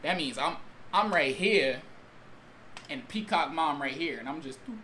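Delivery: normal talking voice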